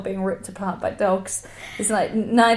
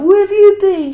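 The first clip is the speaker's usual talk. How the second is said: high, loud mock yell of Essex lads; hammed-up Essex shout; imitating Essex lads; 12 semitones higher, 13 dB louder than usual. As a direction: shout it like lads greeting a lost mate. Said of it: "Essex lads were like".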